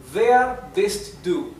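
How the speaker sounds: Wer bist du? slow, stress on Wer; slow model sentence. Shown as a demonstration. Teacher models Wer bist du? slowly, first take. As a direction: Wer bist du? drawn out, Wer leaned on. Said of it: "model sentence, first take".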